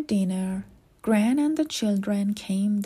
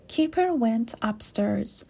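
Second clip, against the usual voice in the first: plain reading voice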